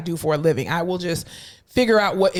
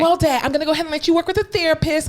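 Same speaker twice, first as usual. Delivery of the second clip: high, chirpy goodbye to dad; high-voiced visitor making an exit; family member excusing themselves; 8 semitones higher than usual. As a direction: pitch it up to slip out politely. Acting out family member leaving Dad.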